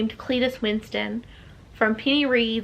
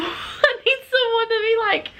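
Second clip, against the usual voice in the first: playful pleading; 11 semitones higher than usual. Real, not acted. Vlogger begging viewers to nag her into reading, playful pleading. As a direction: beg in a much higher, stretched-out voice. Mock-desperate plea to viewers.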